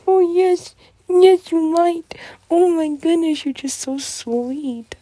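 high, loud cooing, slowing at the end. Real, not acted. Cooing to her puppy in high, loud baby talk. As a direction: gush over the puppy.

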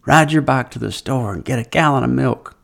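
a hint of mom giving orders; mom's voice.

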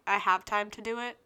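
ordinary talk, nothing marked

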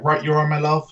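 light West Country lilt; West Country pub greeting. Retold or imitated. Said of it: "this one's from the West Country".

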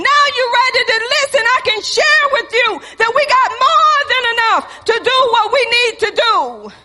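high, loud, laughing mock husband; knowing reply. Husband answering his panicked wife, laughing and loud. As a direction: toss it back with a chuckle.

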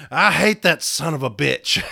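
retold loud, indignant outburst. Witness to those outside after the hearing, loud outburst. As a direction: blurt it out loudly, as she did. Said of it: "Just as loud".

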